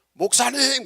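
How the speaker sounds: imitating a churchgoer's loud call. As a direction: call out to the pastor, pitched high. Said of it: "크게 말을 해가지고".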